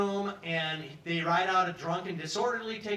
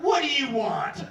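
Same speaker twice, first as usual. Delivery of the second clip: higher, louder, slow annoyed guard voice; gruff guard; 7 semitones higher, 6 dB louder than usual. Retold or imitated. Guard snapping at the prisoner through the window, higher and louder. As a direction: bark the question at a pest. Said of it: "he's like".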